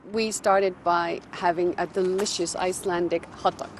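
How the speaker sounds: ordinary talk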